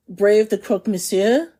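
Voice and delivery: normal talking voice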